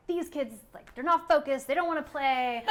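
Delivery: mild mock tantrum; frustrated kid. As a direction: vent like a fed-up little kid. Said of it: "just so frustrated".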